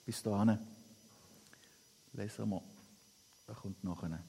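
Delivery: normal talking voice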